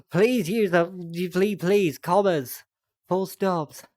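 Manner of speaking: loud, high-pitched pleading; exasperated plea. Real, not acted. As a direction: beg out loud, desperate after running out of breath. Exasperated plea about unpunctuated text.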